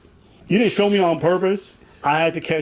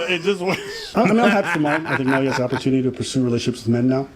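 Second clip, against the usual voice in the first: ordinary delivery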